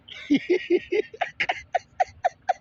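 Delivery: bursting laugh, high; amused high laughter. Real, not acted. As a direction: laugh at your own joke. Laughs after acted quote.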